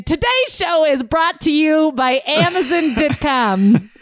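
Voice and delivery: hammed-up podcast sponsor voice; ad read. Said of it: "An ad read".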